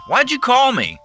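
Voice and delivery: high, loud irritated snap; irritable snapping. A narrator's reading. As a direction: snap it impatiently. Chuck snapping at narrator.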